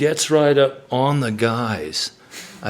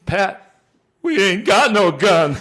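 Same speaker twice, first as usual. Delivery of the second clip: sheepish high admission; 10 semitones higher, 4 dB louder than usual. Retold or imitated. Billy admits no gun, high.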